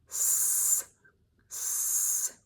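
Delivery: "S, s" hissed softly with the tongue back; soft sustained hiss; retracted s. Shown as a demonstration. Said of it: "move the tongue backwards".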